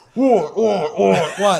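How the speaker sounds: high, loud muffled what; loud distorted what; mic-in-mouth yelling. Retold or imitated. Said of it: "I can hear myself going".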